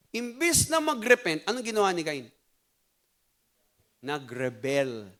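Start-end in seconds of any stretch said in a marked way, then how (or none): none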